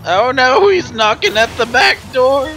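mock alarm.

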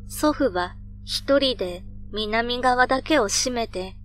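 ordinary delivery

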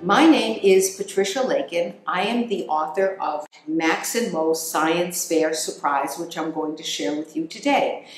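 plain reading voice

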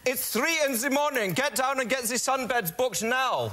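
high-pitched German accent. Shown as a demonstration.

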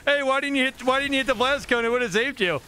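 gloating, repeated jeer broken by cackles; mocking taunt. Real, not acted. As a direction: taunt them gleefully, pitched well up. Mocks opponent's missed escape, laughing.

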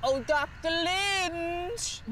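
drawn-out singsong call; teasing sing-song. Acted. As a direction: call him out teasingly, slow.

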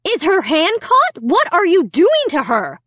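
high, frantic questioning; shrieked accusing questions, pitch leaping; screaming mother. A narrator's reading. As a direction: raise the voice like an alarmed mother. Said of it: "Alicia's mother was screaming".

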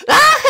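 short, high scream of fright; high startled shriek. Acted. Sudden scream at the villain.

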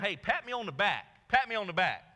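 hammed-up self-righteous disciple; smug John. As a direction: demand praise like a show-off. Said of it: "he's going".

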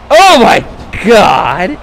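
a sudden high yell of disbelief at the slam; shocked yell. Real, not acted. Commentator reacting to the brutal choke slam, shocked yell. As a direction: cry out as the wrestler crashes onto the steel.

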